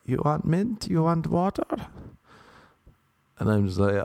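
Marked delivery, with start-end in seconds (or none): 0.1-1.7 high-pitched imitation of the Chinese driver; high, questioning driver voice; Meow. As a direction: mimic her offers, pitched way up. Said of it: "she's just like".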